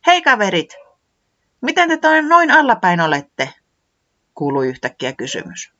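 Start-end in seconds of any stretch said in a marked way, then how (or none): 0.1-3.5 much higher and louder, bright greeting; cheery Tiputi. Acted. Tiputi hailing the gloomy friends, high and loud. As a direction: call out brightly to cheer them up.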